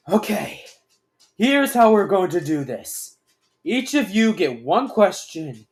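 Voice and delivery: loud, firm orders; commanding. A narrator's reading. Aaron lays down the rules to the group, commanding. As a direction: lay down the rules forcefully.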